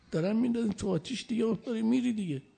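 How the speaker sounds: high, fast urging as they throw him in; urgent pleading. Acted. Gabriel urges Abraham in story. (جبرئیل با اصرار و هیجان به ابراهیم)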